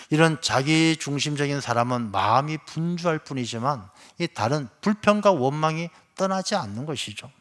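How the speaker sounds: ordinary talk, nothing marked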